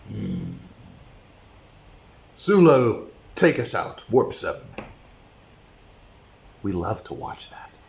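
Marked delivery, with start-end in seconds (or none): 0.1-4.7 steady, unruffled command; calm captain. Retold or imitated. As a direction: give the order coolly. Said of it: "You see him calm".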